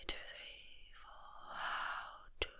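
whispered count on the breath; breathing from the tummy. Shown as a demonstration. Coach demonstrates tummy breathing, whispered count. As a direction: whisper each number as you breathe deeply. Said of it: "move that breath coming from your tummy".

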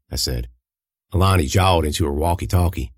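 ordinary delivery

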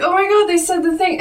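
high, quick retold excitement; excited reaction. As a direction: re-enact a fan's thrilled outburst. Retelling own thrilled reaction.

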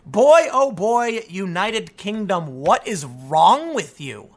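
very slow, drawn-out disbelief; incredulous scolding. Real, not acted. Talking to the United Kingdom, slow, drawn-out disbelief. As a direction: shake your head at a whole country, slowly.

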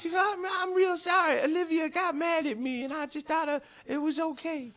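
high, small squeaky cat voice; apologetic cat. Acted. Cat saying sorry to its victim, sheepish squeak.

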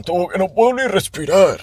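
high, strained stuffed voice; high mock groan of fullness; mock breathless complaint. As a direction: squeak it out like you've overeaten. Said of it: "en plan".

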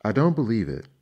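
loud, showy disbelief; loud mock disbelief. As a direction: pretend you can't believe it. Fisherman feigns disbelief.

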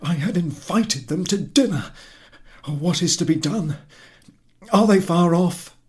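high, loud fretful questions; he scrambles for a plan; husband in a panic over guests; panicked husband. Acted.

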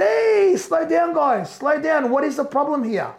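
high-pitched call to slow them down. Retold or imitated. Retells confronting twenty approaching officers.

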